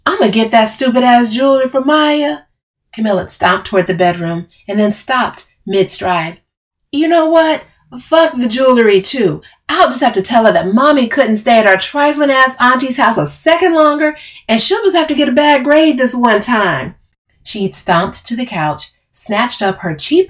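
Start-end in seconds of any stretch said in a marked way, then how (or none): 0.0-2.4 loud, high threat; angry shouting. Acted. Storming off mid-quarrel. 6.9-16.9 loud, high, spiteful rant; angry ranting. Acted. Spiteful threat in the quarrel.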